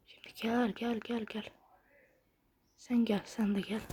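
hushed luring gel; whisper. Pretend. Gamer whispering to lure rival snakes. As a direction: coax them closer in a whisper.

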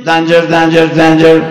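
loud sing-song danger warning; hammed-up danger alarm; mock alarm. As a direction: call it out like a robot alarm.

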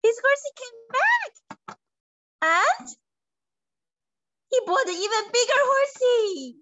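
storyteller's big joyful reveal; excited announcement. A narrator's reading. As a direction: announce the happy news with delight.

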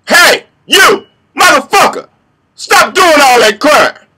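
full-force, high-pitched angry shout; intimidating voice. Shown as a demonstration. Vigilante demonstrating his voice on criminals, intimidating shout. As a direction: bellow it to scare off a criminal. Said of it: "intimidating voice".

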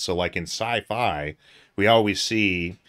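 ordinary talk, nothing marked

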